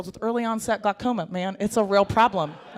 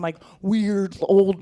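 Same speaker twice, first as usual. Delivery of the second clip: usual speaking voice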